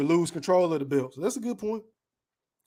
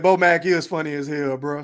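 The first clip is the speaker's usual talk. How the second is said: ordinary delivery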